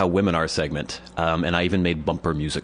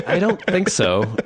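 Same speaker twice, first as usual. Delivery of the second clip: skeptical reply; 9 semitones higher, slower than usual. Retold or imitated.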